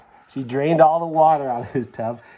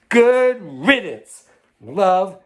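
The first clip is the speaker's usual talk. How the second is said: snooty note voice; 8 semitones higher, 7 dB louder, much slower than usual. Acted. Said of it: "The note will say".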